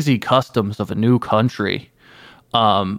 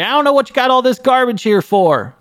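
put-on much higher-pitched drunken gripe; drunk father; 13 semitones higher, 4 dB louder than usual. Drunk father crashing through rice paper walls, high-pitched gripe. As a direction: squeal it out like a drunk dad tumbling through the walls. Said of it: "and say".

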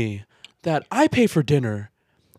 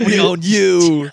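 drawn-out ominous line; a hint of a sinister overlord; mock menacing; 3 semitones higher, 4 dB louder, much slower than usual. Menacing opener.

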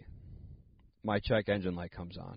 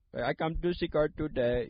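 higher mock Indian accent; playful imitated Indian accent; 6 semitones higher than usual. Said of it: "I read it in an Indian accent".

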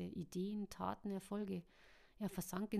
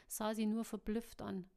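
usual speaking voice

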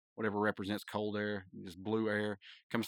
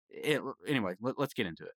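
usual speaking voice

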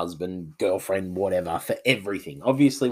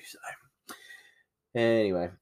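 ordinary talk, nothing marked

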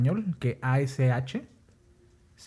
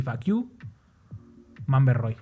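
Spanish accent English; 3 semitones lower than usual. Shown as a demonstration. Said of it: "Así".

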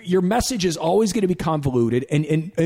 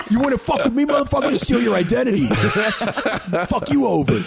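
mock tough guy; 6 semitones higher, faster than usual. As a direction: shout a silly threat with a laugh. Mocking tough guy, sung and shouted.

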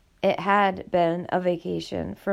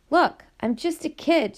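higher, pleading voice; 7 semitones higher than usual. Acted. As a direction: beg them to understand. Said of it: "she pleaded".

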